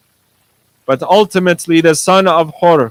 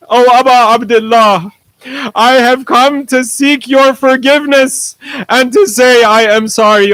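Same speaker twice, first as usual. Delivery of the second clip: raised, impassioned penitent plea; pleading for forgiveness; 4 semitones higher than usual. Acted. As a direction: beg the Imam with urgent remorse. Hur begs the Imam's forgiveness.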